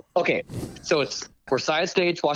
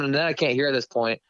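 ordinary talk, nothing marked

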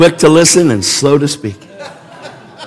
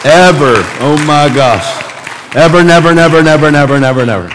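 louder, higher preaching shout on never; 4 semitones higher, 5 dB louder than usual. Real, not acted. Preacher vowing never to water down the message, shouting. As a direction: hammer every never home.

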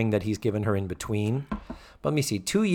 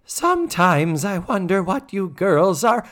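high, loud, drawn-out busybody; hammed-up suspicious housemother; Mrs. Garrett impression; 7 semitones higher, 7 dB louder, slower than usual. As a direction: stretch the words out in a loud, pointed singsong. Acting out Mrs. Garrett's suspicious remark.

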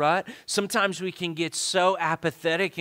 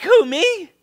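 high-pitched, acted disbelief; mock-startled question; incredulous; 11 dB louder than usual. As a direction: squeak it out as if wrongly picked. Voices startled Gideon.

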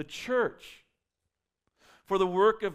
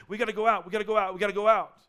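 louder, speeding up with each repeat; eager push; 3 semitones higher, 6 dB louder, faster than usual. Retold or imitated. Preacher voicing eager apostles pushing the church outward, excited and fast. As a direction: urge them out the door. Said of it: "in the church going".

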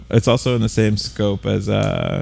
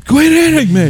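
fluttering cries for help; 6 dB louder than usual. Pretend. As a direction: cry out high, hammed-up. Mock cries from the mic.

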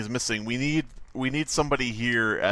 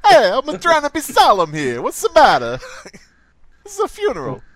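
loud, high mock Italian mobster protest; Solemn Tony; 10 semitones higher, 7 dB louder than usual. Tony objecting at a funeral, hammed-up Italian accent. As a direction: complain indignantly in a fake Italian accent. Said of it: "that solemn Tony's thing".

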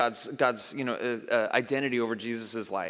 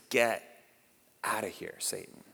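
quiet rebuke; 5 semitones lower, 6 dB quieter than usual. Retold or imitated. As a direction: pause after the first word, then sink softly. Jesus's rebuke of Peter retold.

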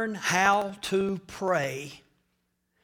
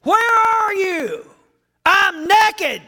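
calling out; 11 semitones higher, 9 dB louder, slower than usual. Acted. Preacher acting God and Adam.

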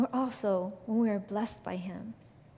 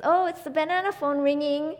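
higher, louder pretend excitement; playful parent-to-child talk; 5 semitones higher, 5 dB louder than usual. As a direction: play along with a little kid's phone game. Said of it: "talk to her kids that way".

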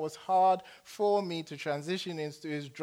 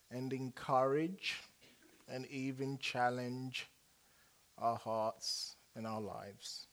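prayer; 3 semitones lower than usual. Acted. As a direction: pray gently, drawing the room inward. Pastor leads congregation in prayer.

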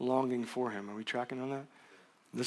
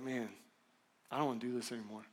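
broken down; 2 semitones lower, 8 dB quieter than usual. Retold or imitated. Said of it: "I just broke emotionally".

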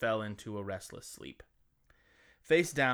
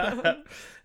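high bursting laugh; burst of laughter; 8 semitones higher, 7 dB louder than usual. Real, not acted. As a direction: crack up at the pun. Laughs at own pun.

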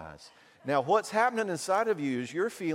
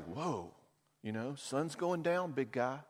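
soothing wife; 8 dB quieter than usual. Retold or imitated. Wife calming her husband.

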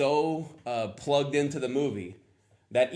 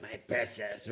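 softer, groveling creature voice; lightly voiced Gollum, softer and groveling; 5 dB quieter than usual. Shown as a demonstration. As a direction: grovel over the word like Gollum. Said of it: "groveling voice".

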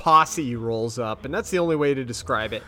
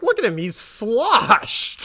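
exasperated; 7 semitones higher, 3 dB louder, slower than usual. Retold or imitated. Mulder pointing at drunk Samuel.

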